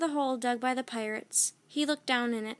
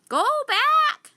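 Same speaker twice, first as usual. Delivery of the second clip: much higher, louder parrot voice; a parrot warns the children; squawked; 11 dB louder than usual. A narrator's reading. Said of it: "squawked Polly".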